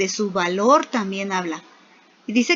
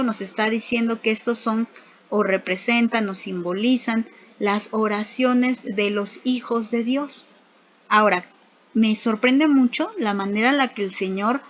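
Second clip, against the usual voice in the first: ordinary talk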